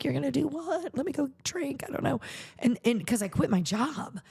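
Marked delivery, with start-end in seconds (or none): 0.0-2.2 stunned husband. Retold or imitated. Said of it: "I think he was like".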